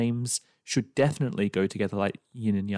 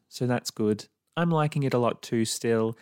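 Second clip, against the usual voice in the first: ordinary talk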